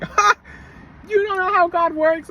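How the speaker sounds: high, loud scoffing laugh then rebuke; retold scornful laughter; mocking laugh. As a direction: laugh at his excuse. Mocking the driver's excuse.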